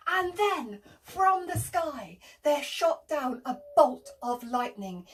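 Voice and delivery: plain reading voice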